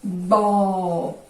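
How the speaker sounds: "Bo" held long on a level pitch; sustained, deliberate "Bo" for the class; isolated syllable demo. Shown as a demonstration. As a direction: draw out "Bo" as a syllable on its own. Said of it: "a primeira vez que eu abri é uma sílaba".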